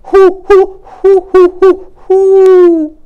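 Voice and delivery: high, loud hooting owl call; long, ringing owl hoots; great horned owl call. A narrator's reading. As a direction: hoot it out like a great horned owl across the snow. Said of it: "Then Pa called".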